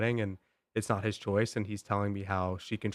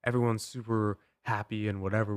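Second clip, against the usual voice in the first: normal talking voice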